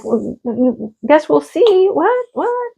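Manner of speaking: stammer, then very high What; sputter, then squeak out What twice; reacting to her husband's vague answer, stammering then squeaking in shock; stunned disbelief. Stunned by "I guess we'll see.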